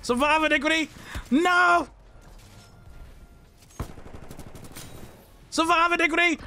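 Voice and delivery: high, pumped-up battle cry; rallying shout. Real, not acted. Gamer shouting to teammate Iniquity, high rallying yell.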